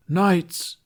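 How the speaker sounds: high, commanding king's call; ordering as Arthur. A narrator's reading. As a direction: summon the knights like a stage king. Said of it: "Tooth ordered as Arthur".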